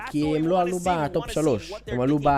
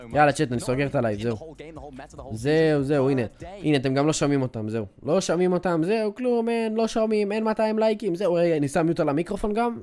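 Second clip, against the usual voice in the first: ordinary delivery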